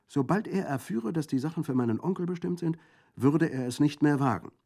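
high, bright-voiced little girl answering readily; a quick-witted village child, unafraid; young girl. A narrator's reading. Girl's voice in dialogue.

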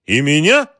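high, loud disbelief; startled question. A narrator's reading. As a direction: jolt at the news of arrest. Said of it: "дёрнулся президент". (ошеломлённо, громко и высоко)

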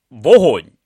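sudden loud order; firing command. A narrator's reading. Camel finishes his aiming sums and fires, a loud command. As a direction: punch out the word as a loud command.

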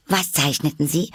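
put-on high, curious girl's question; forgetful interruption. A narrator's reading. Alice interrupts despite her promise, high and curious. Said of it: "ihr Versprechen ganz vergessend".

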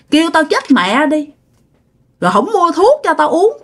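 high-pitched, aggrieved reproach; acted indignant scolding; grandfather's spirit scolding. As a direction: retell a dead grandfather berating his child, pitch jumping up. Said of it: "ông nội nhập về mà nói vậy".

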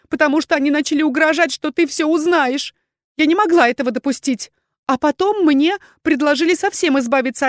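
high, loud, pleading woman's voice; overwrought, high-pitched justification; desperate confession. A narrator's reading. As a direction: pour out excuses, begging to be believed. Accused woman's frantic confession.

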